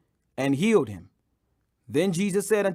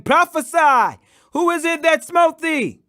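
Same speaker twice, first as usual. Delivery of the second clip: much higher and louder jeer; mocking taunt; 8 semitones higher, 5 dB louder than usual. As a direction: taunt the blindfolded prisoner. Guards mock blindfolded Jesus.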